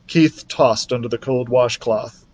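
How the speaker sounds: ordinary delivery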